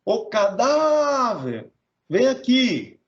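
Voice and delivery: "cadáver" called high with DÁ held long; calling the word. Shown as a demonstration. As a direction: summon "cadáver" like a person, stretching the DÁ. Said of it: "chamando ele segura mais a sílaba tônica".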